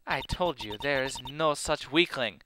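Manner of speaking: put-on slow, muddled Russian-German accent; made-up foreign accent. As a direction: insist slowly in a vague half-German, half-Russian accent. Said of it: "what in the hell kind of accent that is".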